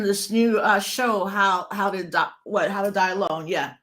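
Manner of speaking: ordinary delivery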